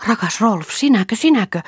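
high, excited questioning of a beloved; acted breathless-with-wonder recognition; astonished recognition. A narrator's reading. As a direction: cry out in joyful disbelief, pitched high. Recognizing a long-lost beloved.

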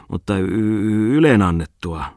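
slow, stumbling tipsy stammer on the y. Acted. Softened editor stammers his praise, a bit drunk. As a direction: let the drink trip the start of the word. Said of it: "sanoi pehminyt toimittaja".

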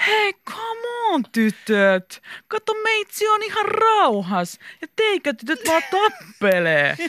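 put-on high, drawn-out cool-kid swagger; exaggerated slow tough-boy act; tough-guy voice. A narrator's reading. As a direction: play the tough guy, stretching the words. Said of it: "sanoi Mika kovis äänellä".

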